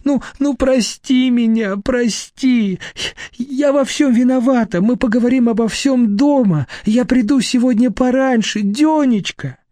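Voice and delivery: high-pitched tearful begging; crying plea. A narrator's reading. Wife begging husband's forgiveness, crying. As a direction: plead with him through tears. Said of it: "Вера заплакала".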